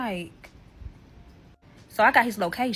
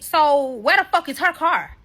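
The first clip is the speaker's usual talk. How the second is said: loud, high-pitched interrogation; heated confrontation; 6 semitones higher, 5 dB louder than usual. Retold or imitated. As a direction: retell it as a forceful demand. Retells confronting him about car.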